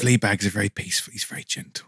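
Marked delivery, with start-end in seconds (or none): 1.1-1.9 unvoiced softer line; whisper. Guest whispers about his sleeping dog. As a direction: breathe it gently.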